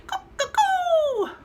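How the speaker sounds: very high, loud cuckoo; bird call. Acted.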